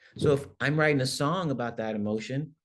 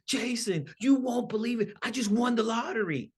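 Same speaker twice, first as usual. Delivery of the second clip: higher, bright excited telling; excited news; 11 semitones higher than usual. Shown as a demonstration. Telling a friend about a lottery win, excited and high. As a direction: burst out with the big news. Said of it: "my voice goes up".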